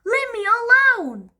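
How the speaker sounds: high, loud cry of frustration; exasperated shout. Acted. Dad shouting at Granny to back off. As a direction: burst out at her.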